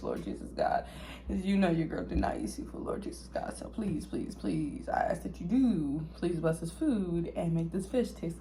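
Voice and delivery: hushed, fast prayer, dropping to a whisper; mock prayer. Asking God to bless the fish, a hushed mock prayer. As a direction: plead with the Lord in a hushed half-joking prayer.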